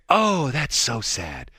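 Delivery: slow, drawn-out mock commiseration; imitated sympathy. Host voicing the nice lady hearing of his accident, syrupy sympathy. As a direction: mimic a kindly lady pouring on pity. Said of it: "she couldn't have been nicer".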